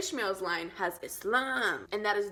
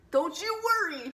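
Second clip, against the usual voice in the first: DON'T YOU WORRY pitched high and punched; hammed-up, higher and stronger reassurance; emphatic reassurance; 7 semitones higher, 4 dB louder than usual. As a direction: reassure like an excited hype man. [name] repeats it with more force.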